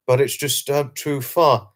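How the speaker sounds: ordinary delivery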